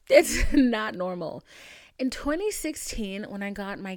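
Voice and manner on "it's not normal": loud, slow, laughing through it; laughing disbelief. Real, not acted. Venting to viewers about payments, laughing loudly. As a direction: laugh it out, stretching the words.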